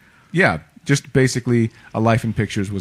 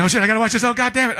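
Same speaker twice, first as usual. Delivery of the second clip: high, eager mock excitement; can't wait to watch it; excited; 13 semitones higher, much faster than usual. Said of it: "I'm like".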